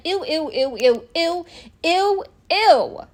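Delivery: escalating grossed-out ews; disgusted ew. Real, not acted. Disgust at man's comment.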